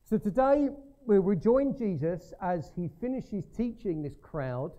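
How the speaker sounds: loud, high preaching burst; preaching at full force. Acted. Preacher rousing the congregation, loud and high. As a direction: ring it out to the whole church.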